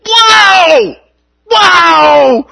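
very high, loud shouted wow; yelled wow. Retold or imitated. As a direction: shout it out to stir the class. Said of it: "I just yelled out".

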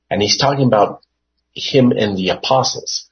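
normal talking voice

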